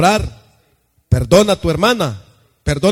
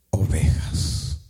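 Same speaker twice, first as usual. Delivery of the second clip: hushed whisper; 12 semitones lower, 5 dB quieter, slower than usual. Acted. Jesus speaks to Peter.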